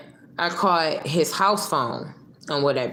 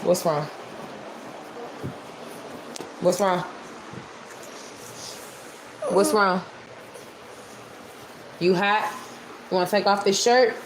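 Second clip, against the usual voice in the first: tender coaxing; 4 semitones higher, 4 dB quieter than usual. Real, not acted. Talking to a child nearby.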